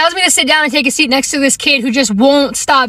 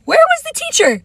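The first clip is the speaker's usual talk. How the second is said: much higher, exasperated question; incredulous outburst; indignant; 10 semitones higher than usual. Real, not acted. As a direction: demand it in high-pitched disbelief. Indignant rhetorical question, then repeated.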